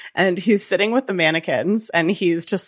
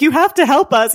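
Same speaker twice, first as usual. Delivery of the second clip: high, louder mock plea; imitated pleading; 9 semitones higher, 5 dB louder than usual. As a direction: beg urgently, as a retelling. Retold plea to the guard.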